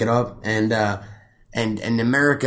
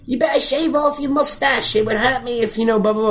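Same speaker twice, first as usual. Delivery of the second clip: much higher mock girlish voice; girl in the movie; 10 semitones higher than usual. Host mocking a woman from the film in a much higher voice. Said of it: "some girl in the movie was like".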